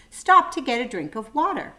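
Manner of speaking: plain reading voice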